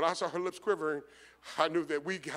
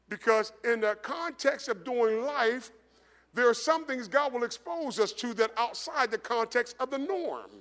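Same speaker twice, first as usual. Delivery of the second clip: higher, louder preaching climb that slows down; preaching at full force; 6 semitones higher, 6 dB louder than usual. Real, not acted. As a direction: build the sermon to its peak. Sermon builds to climax.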